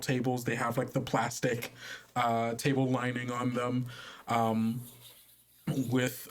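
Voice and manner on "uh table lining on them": normal talking voice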